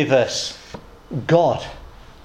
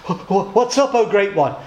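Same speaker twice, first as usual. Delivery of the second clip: stammering, higher-pitched mock fright; terrified monkey; 3 semitones higher than usual. Monkey answering the roaring lion, acted terror. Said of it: "This terrified monkey says".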